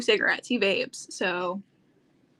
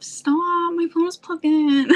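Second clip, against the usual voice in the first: louder, higher playful complaint; mock-annoyed outburst, pitched up; exasperated protest; 8 semitones higher, 6 dB louder than usual. Real, not acted. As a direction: protest loudly at a nagging device. Complaining at a battery pop-up.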